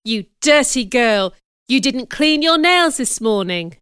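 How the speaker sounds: loud, angry telling-off; scolding cry. Acted. Teacher berating a girl for dirty nails, loudly scolding. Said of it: "she cried".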